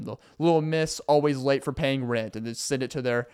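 ordinary delivery

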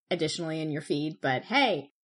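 normal talking voice

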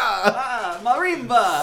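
loud, high Caribbean caricature with laughter; hammed-up island accent, Caribbean; mock Caribbean accent. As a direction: shout a jokey Caribbean lilt, laughing.

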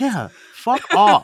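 slow, high scornful brush-off; dismissive fuck off. Real, not acted. Host scoffing at a bloated runtime, dismissive. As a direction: wave it away with contempt. Mocking a long movie pitch.

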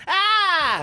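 high, loud drawn-out "ah!"; fake cry of pain. Player faking injury to friend, pained yell. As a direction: yelp as if struck hard. Fakes pain after being hit.

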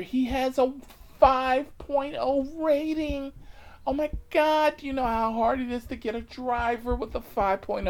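high, loud, campy gushing; gushing flamboyant passenger. Retold or imitated. Passenger gushing to the officer about the driver, hammed-up relief.

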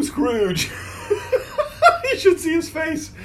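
words spilling through high laughter; laughing. Real, not acted. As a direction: laugh while saying it. Laughing over Scrooge McDuck.

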